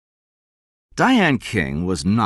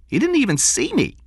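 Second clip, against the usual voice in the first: hurt woman's voice; 3 dB louder than usual. A narrator's reading. Narrator voicing Diane.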